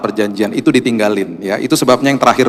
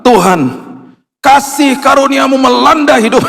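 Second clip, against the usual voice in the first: prayer; 11 semitones higher than usual. Retold or imitated. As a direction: lift the prayer up high. Praying aloud over the man. (doa berapi-api, suara tinggi)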